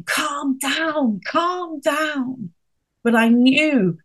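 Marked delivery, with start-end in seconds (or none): 0.0-2.5 higher, pleading calm down; soothing to child. Retold or imitated. Mother to her screaming son, a higher pleading calm down. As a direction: coax a raging little boy to settle. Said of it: "I wanted to tell him".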